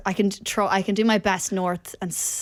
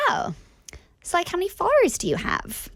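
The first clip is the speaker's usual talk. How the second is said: put-on high, lilting valley-girl question; LA party girl; 11 semitones higher than usual. The LA girl asks about followers, high valley-girl voice. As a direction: act the impressed-but-judgy LA girl. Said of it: "I'm gonna do the impersonation as well".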